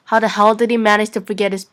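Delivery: ordinary talk, nothing marked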